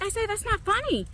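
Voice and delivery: high-pitched exasperated telling-off; whiny, put-out protest; retold scolding. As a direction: scold the laugher without raising volume. Retold scolding of laughing son.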